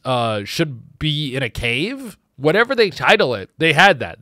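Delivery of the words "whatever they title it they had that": ordinary delivery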